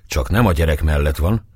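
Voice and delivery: plain reading voice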